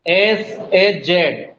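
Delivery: letters said very slowly one by one; very slow letter-by-letter spelling. Shown as a demonstration. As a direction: spell the sounds slowly for the class. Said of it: "एस का उच्चारण जेड".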